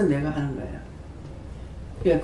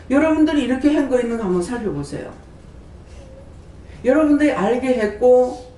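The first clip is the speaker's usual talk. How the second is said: fervent preaching; 4 semitones higher than usual. Acted. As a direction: press the point to the congregation. Preaching builds up. (설교자가 신도들에게 열정적으로 높여 외치듯)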